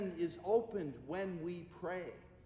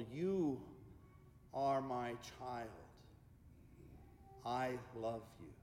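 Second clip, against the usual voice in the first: slow God voice, fading low and soft; 4 semitones lower, 7 dB quieter, slower than usual. Acted. God speaks to each praying family, slow and softening. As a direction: say it slowly as God, with long pauses. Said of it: "hear the voice of God saying".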